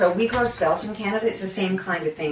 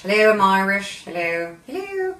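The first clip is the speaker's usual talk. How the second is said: put-on playful Irish accent; 2 semitones higher, 4 dB louder than usual. Host joking about her Irish name, playful Irish accent. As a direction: put on a light Irish lilt. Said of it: "it's Irish".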